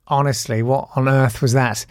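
ordinary talk

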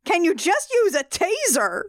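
high exasperated plea. Real, not acted. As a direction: scold like a fed-up parent. Scolding Kate.

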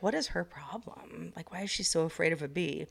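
whispered, scornful judgement; mocking whisper. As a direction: whisper it like a snide stranger. Imitating the man's scornful thought.

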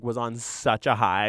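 normal talking voice

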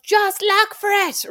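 hoog en langzaam smekend; just look for it, drawn out high in despair; exasperated plea. Retold or imitated. Exasperated at dad.

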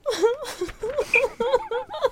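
high, whimpering nervous laugh; tearful giggle of dread; near-tears whimpering laugh. Real, not acted. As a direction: laugh as if about to cry. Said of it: "I'm gonna start crying".

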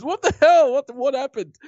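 high-pitched imitation of a bewildered man; mock startled. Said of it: "he just goes".

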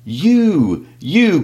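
high, loud furious outburst; angry cry. Acted. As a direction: cry it out in sudden rage. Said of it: "cried Thorn".

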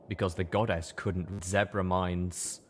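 plain reading voice

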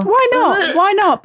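excited. Real, not acted. Eager to try the drink.

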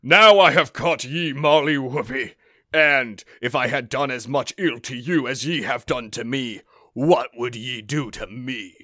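loud gruff giant; big menacing giant; booming giant voice. Acted. Voicing the giant.

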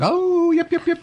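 very high yapping coyote yips; yappy chihuahua voice. Retold or imitated. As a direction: yip it out like a tiny dog. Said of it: "I imagined everybody at a baseball game going".

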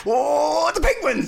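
put-on high, gleeful kid's cheer; excited child. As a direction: squeal like a kid at the zoo. Mock child excited at the zoo.

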